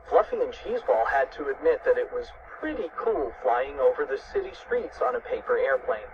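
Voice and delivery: ordinary delivery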